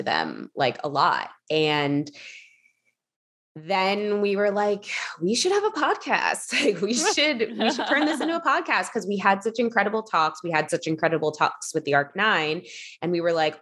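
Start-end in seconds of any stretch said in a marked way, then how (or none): 5.2-8.9 high-pitched retold enthusiasm, with a laugh; excited idea. Two friends deciding to start a podcast, excited. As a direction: pitch it up like a sudden brainwave.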